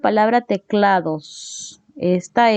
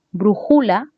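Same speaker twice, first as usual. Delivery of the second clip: "Brújula" stressed on JU, as bruJÚla; misaccented "Brújula" with a strong JU; wrong middle stress. Shown as a demonstration. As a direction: move the accent onto the middle syllable of "Brújula". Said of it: "son formas incorrectas".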